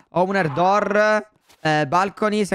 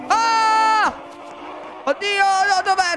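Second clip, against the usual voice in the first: much higher panicked yelp; high frightened outburst; startled cry; much slower than usual. Real, not acted. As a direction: yelp in alarm under attack. Attacked by unseen enemy.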